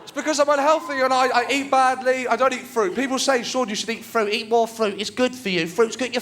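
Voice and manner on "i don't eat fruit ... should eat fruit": ordinary delivery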